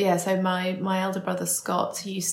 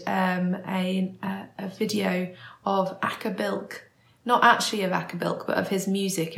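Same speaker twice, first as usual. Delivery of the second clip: ordinary talk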